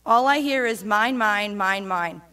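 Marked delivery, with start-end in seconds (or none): none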